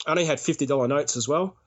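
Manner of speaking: ordinary talk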